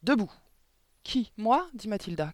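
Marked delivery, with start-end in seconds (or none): 0.0-0.3 short, sharp command; stern, abrupt command; barked order. A narrator's reading. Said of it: "aboya-t-elle". 1.0-1.7 high, loud, incredulous question; indignant surprise; startled protest. A narrator's reading. Accused pupil protests in surprise.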